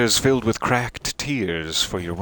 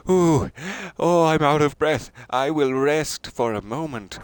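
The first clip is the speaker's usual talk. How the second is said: winded traveler; 7 semitones higher than usual. Pretend. Streamer imagining a dead man's last walk, mock winded. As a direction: play a worn-out wanderer puffing aloud. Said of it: "he was walking this way like".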